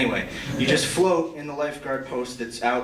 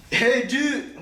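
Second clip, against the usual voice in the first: higher, laid-back surfer-dude greeting; cool lifeguard voice; 8 semitones higher than usual. Shown as a demonstration. To a swimmer, playing the cool lifeguard, breezy call. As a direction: play the cool lifeguard calling out. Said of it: "I don't actually talk like that".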